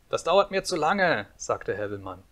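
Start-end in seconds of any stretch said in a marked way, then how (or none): 0.1-1.3 put-on high, loud childish complaint; impatient little boy. A narrator's reading. Impatient boy voiced in a story. (=ungeduldiger kleiner Junge)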